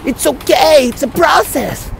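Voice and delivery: put-on high, slow Quebec-accented "It's okay, it's a process"; Quebec English phrase. Quoting his old Quebec English phrase, high and slow. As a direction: toss off the Quebec English catchphrase. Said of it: "Quand que je dis".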